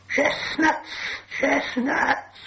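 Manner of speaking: high, hissing creature; hissed creature voice. A narrator's reading. Gollum scornfully answering an old riddle, high hiss. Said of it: "he hissed".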